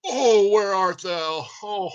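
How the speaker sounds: slow, high and loud mock Romeo; hammed-up Shakespeare. Panicking actor hams Romeo onstage, high and loud. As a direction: ham up the stage line as a panicking actor. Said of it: "all of a sudden you're like".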